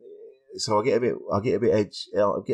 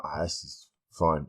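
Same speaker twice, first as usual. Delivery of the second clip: low, slow, careless shrug of a line; imitating being far too calm; too relaxed; 6 semitones lower, 7 dB quieter, slower than usual. Comic before a gig, mock too calm. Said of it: "If I'm like".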